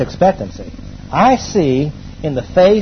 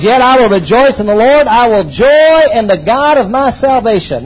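fervent scripture; 6 semitones higher, 6 dB louder than usual. Acted. Pastor proclaiming the prophet's words, fervent and loud. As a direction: ring out the verse like a triumph.

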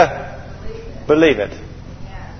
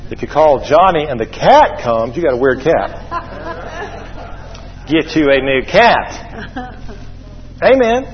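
ordinary delivery

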